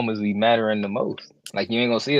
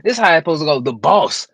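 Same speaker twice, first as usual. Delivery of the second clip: loud excited call out; hyped; 4 semitones higher, 5 dB louder than usual. Real, not acted. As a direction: greet the boss with hype. Announcing the boss.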